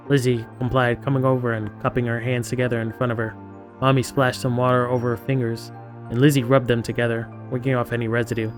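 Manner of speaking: soft, even narrator's read; story narration. A narrator's reading. Reading out Lizzie washing her hands, quiet story narration. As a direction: read the action out quietly and evenly. Reads game narration aloud.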